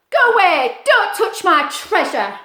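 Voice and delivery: loud, angry lobster growl. Acted. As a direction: snarl it at the intruder. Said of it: "the lobster growled".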